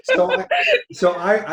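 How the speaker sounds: laughing through the words; words broken by real laughter; giggling as she starts to speak. Real, not acted. Laughing at her joke.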